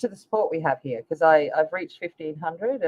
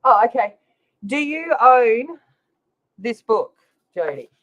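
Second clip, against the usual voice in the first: louder, higher mock challenge; a playful mama-bear challenge, slowing on own; mock protective; 5 semitones higher, 6 dB louder than usual.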